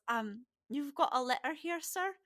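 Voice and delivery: nervous, deferential youngster; uncomfortable boy. Acted. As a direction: timidly lift the end into a question. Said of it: "you can tell he's very uncomfortable".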